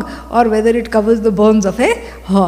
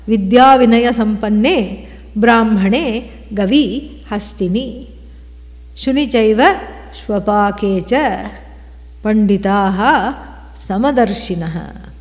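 Sanskrit recitation; 3 semitones lower than usual. Acted. Recites Gita verse.

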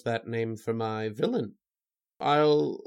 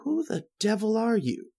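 high-pitched startled challenge; startled demand; 9 semitones higher than usual. Acted. Writer to the stranger in his room, startled demand. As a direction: demand it, pitch jumping in alarm.

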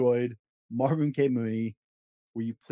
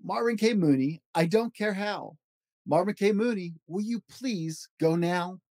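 lively read-aloud of a rhyming picture book; storybook voice, playful and rhythmic; reading to children; 5 semitones higher than usual. Acted. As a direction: bounce through the rhymes for a child at bedtime. Reads Dr. Seuss book aloud.

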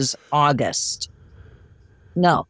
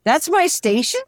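much higher, rising in disbelief; disbelieving; 10 semitones higher than usual. Retold or imitated. To the girlfriend on hearing her station, high incredulous question. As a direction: ask it as if it can't be true.

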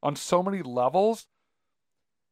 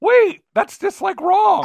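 high, slow mock disbelief; incredulous; 4 dB louder, much slower than usual. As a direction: squeak it out, shocked and slow. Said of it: "You know, you're like".

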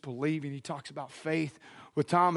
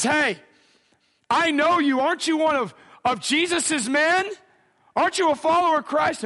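higher, louder onlooker calling Peter out; loud accusing challenge; 9 semitones higher, 4 dB louder than usual. Retold or imitated. Someone in the crowd challenges Peter, loud and accusing. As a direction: press the question loudly, pitched up.

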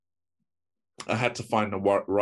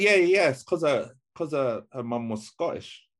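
ordinary delivery

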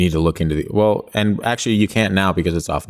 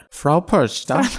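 slow, high German accent on "Frau Perchta"; 8 semitones higher, much slower than usual. Shown as a demonstration. Asked to say it in German, a light mock German accent. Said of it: "say it in a German accent".

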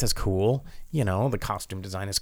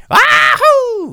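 high, loud mock yell; Momoa scream; 12 dB louder than usual. Podcaster mimicking Momoa's screaming, very high and loud. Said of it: "screams a lot".

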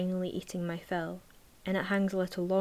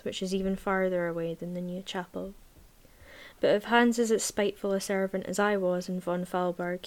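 usual speaking voice

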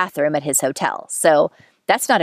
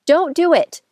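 "Don't do it" flung out much higher; emphatic warning; 11 semitones higher than usual. Shown as a demonstration. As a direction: cry "Don't do it" out like an alarm. Warning viewers against the mistake.

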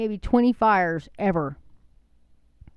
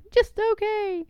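high, sarcastic sneer at the slogan; scoffing at a state license plate, high and sarcastic; mocking; 13 semitones higher, slower than usual. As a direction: pitch it way up to mock it. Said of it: "I'm like".

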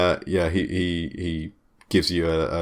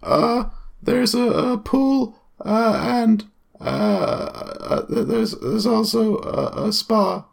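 put-on much higher, hesitant teenage clerk; gym clerk voice; slower than usual. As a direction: squeak out as the nervous acne-ridden clerk.

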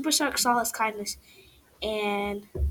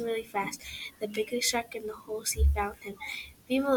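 ordinary delivery

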